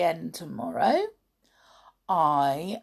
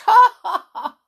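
high, loud ha ha ha; belated laugh; 13 dB louder than usual. A narrator's reading. George laughs at joke.

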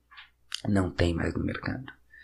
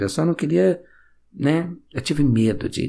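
ordinary talk

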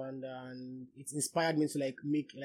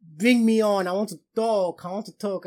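excited begging; 5 semitones higher, 10 dB louder than usual. Retold or imitated. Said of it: "I've always been begging him, like".

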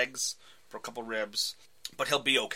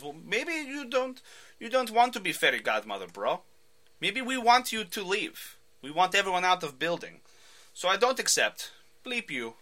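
put-on Russian thug accent; 7 semitones higher than usual. Ivan refusing to sell.

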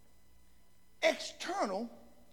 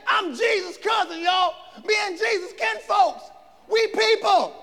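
loud, higher name-dropping swagger; mock bragging; 10 semitones higher, 9 dB louder than usual. Preacher mocking a proud John. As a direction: show off the family ties.

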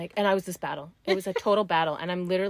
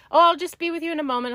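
high, quick sing-song cashier voice; breezy brush-off from a busy cashier; lightly mocking the cashier's cheerful tone; chirpy cashier; 9 semitones higher, 6 dB louder, faster than usual. Cashier quoted in store story.

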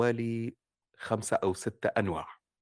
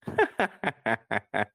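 gentle mother's laugh. Shown as a demonstration. Mother laughs before answering.

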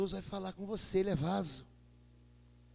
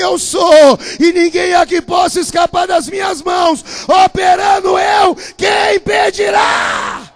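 booming shouted sermon voice; roaring pulpit proclamation; full-force preaching; 7 semitones higher, 23 dB louder, slower than usual. Acted. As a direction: thunder it out as God's own words. Preaching at full force, quoting God.

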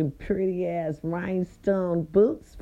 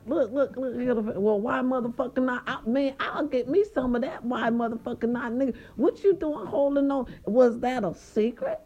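higher-pitched imitation of nosy buddies; eager friends; 6 semitones higher than usual. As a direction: playfully mimic the guys pressing for answers. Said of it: "they was like".

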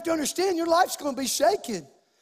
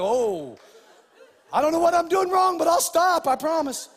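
put-on higher, louder panicked kid pleading; scared kid; 5 semitones higher, 5 dB louder than usual. Pastor voicing himself as a scared boy, pleading high. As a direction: plead like a caught child. Child hearing dad leap up.

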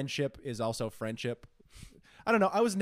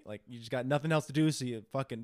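ordinary talk

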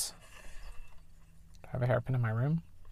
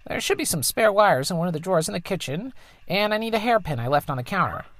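higher, lightly put-on mother voice; mom's voice; 6 semitones higher than usual. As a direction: play the tinkering engineer mom, a bit higher. Voices the mom.

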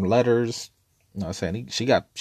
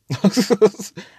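loud, high laugh at his own joke; high burst of laughter; 13 semitones higher, 7 dB louder than usual. Real, not acted. Laughing while retelling what he told his cellmate. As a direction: crack up mid-story.

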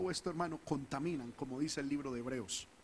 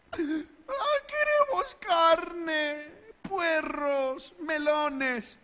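much higher, louder, slow wailing whine; whiny crying people; 13 semitones higher, 9 dB louder, much slower than usual. Retold or imitated. Preacher mocking complaining Israelites, high wailing whine. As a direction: wail it like a spoiled crowd begging for meat. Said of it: "cada uno se ponía a llorar".

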